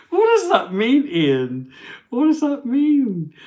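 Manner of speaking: high, loud exasperated squeal; very high-pitched, drawn-out puzzled questioning; comic bafflement. Real, not acted. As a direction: demand an explanation in a high squeal. Baffled, laughing at viewer's comment.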